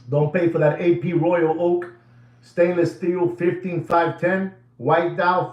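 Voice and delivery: ordinary talk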